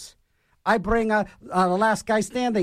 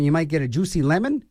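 ordinary delivery

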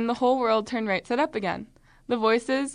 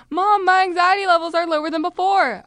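high, loud, gleeful news; elated, raised voice; excited announcement; 9 semitones higher, 7 dB louder than usual. A narrator's reading. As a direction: call the big news out to mom. Shares good news with mom.

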